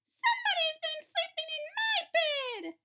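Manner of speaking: very high, loud squeaky cub voice; baby bear crying out about his bed; Baby Bear's loudest little voice. A narrator's reading. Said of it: "said Baby Bear in his loudest little voice".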